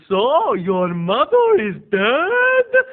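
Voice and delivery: loud, high, very drawn-out question; hammed-up slow, loud accusing question; imitated eccentric aunt. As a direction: morbid aunt impression, stretching every word. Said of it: "and she goes, right in the graveyard scene".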